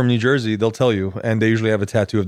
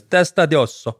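higher, put-on Italian voice; Italian accent; 4 semitones higher than usual. Translating bonehead for a co-host, Italian accent. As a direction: slip into a stagey Italian accent. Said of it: "I changed my voice when I said".

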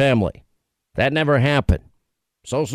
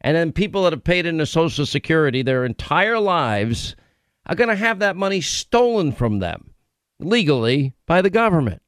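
usual speaking voice

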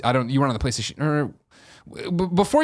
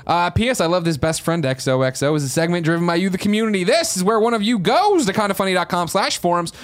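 show host segment intro; 5 semitones higher than usual. Acted. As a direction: sell it like a podcast host.

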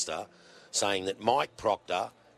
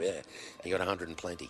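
ordinary talk, nothing marked

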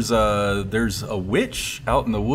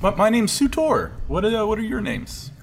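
a higher, sweet tavern lady voice; barmaid voice; 10 semitones higher than usual. Acted. Game master voicing the barmaid talking to the adventurers, sweetly. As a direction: lift the voice for the flirty barmaid.

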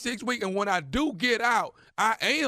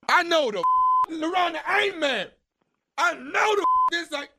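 high, stunned outburst; shocked disbelief; 7 semitones higher than usual. Acted. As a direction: act floored by the news. Caller hears she is married.

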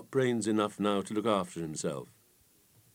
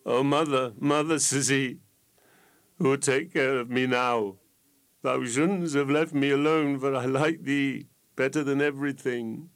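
raised, tearful cry to his dead mother; sobbing grief; 6 semitones higher, 5 dB louder than usual. A narrator's reading. Fool sobbing over his dead mother, higher and louder. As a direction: weep it out, calling for mother. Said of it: "while he began to sob and greet".

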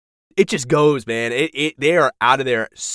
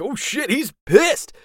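high, stretched-out mock alarm; stunned viewer reaction; 11 semitones higher, slower than usual. Podcaster voicing a viewer watching Vader rage, stunned reaction. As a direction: act out a startled onlooker, pitched high.

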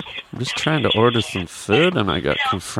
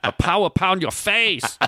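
mock accent; 6 semitones higher than usual. Mocking the restaurant owner.

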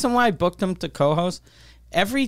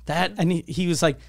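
ordinary talk

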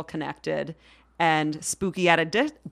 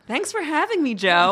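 bright formal radio greeting; radio interview voice; 7 semitones higher than usual. Real, not acted. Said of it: "like a radio interview".

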